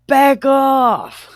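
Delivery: sudden high, loud comic retort; hammed-up warning yell; mock shout. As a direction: burst out in pretend defiance.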